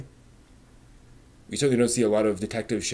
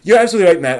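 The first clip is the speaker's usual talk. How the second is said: eager agreement; 6 semitones higher, 9 dB louder, much faster than usual. Real, not acted.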